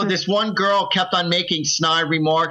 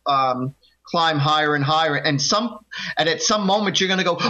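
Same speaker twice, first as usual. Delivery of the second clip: usual speaking voice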